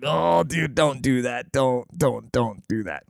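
soft, pained plea under his breath; cringing plea. Retold or imitated. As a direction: beg him to stop, wincing. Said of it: "I'm like".

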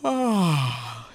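open-mouthed sighing "ah"; sighing yawn. Shown as a demonstration. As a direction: let out a long yawning sigh, falling away. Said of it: "do a few sighing yawns".

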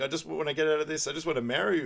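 lightly voiced lovestruck girlfriend; imitating Angie. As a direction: coo it like she's smitten. Voicing Angie on the phone.

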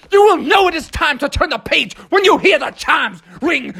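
booming high monster call for the page chimes; hammed-up monster announcer. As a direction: announce it like a big goofy monster. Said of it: "You sounded like a monster".

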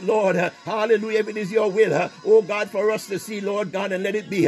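ordinary delivery

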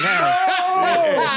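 drawn-out, high, laughing wail; playful, hammed-up shout; mock ecstatic cry. Acting out enjoying being degraded.

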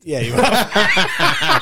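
laughing. Real, not acted. Mocking a friend who was fooled.

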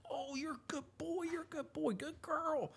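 put-on very high, excited baby-talk praise to the puppy. Shown as a demonstration. Trainer praising the puppy for bringing the sock, squeaky gushing. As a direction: gush over the pup in a squeaky voice. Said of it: "overdo that".

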